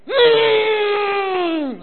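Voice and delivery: long, loud, very high held squeal; mock electrocuted cry. Preacher mimicking the congregation's reaction, mock electrified squeal. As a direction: squeal like someone plugged into an electric chair. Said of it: "like someone that is plugged to electric chair".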